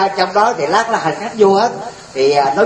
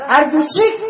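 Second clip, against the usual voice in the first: much higher, exasperated question; the American getting worked up, pitched up; high incredulous American; 11 semitones higher than usual. Retold or imitated. American losing patience with the prime minister, high exasperated voice. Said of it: "thằng Mỹ này ... nóng gà".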